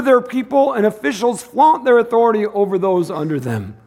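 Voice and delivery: high, loud, driving sermon voice; fiery preaching. Acted. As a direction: preach it out to the church. Preaching at full force.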